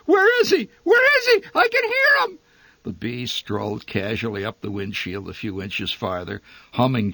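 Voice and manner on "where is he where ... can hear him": very high, loud panicked scream; terrified shriek. A narrator's reading. Mother cowering on the floorboards, shrieking in terror. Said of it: "interrupted her whimperings long enough to shriek".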